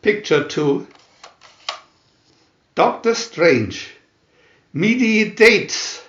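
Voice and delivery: ordinary delivery, nothing marked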